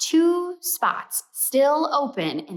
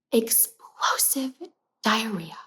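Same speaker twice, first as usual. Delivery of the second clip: slow whispered reveal; 3 dB quieter, slower than usual. Real, not acted. Revealing what the cat did, in a slow whisper. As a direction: breathe the word out in a hush.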